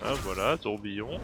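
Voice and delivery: ordinary talk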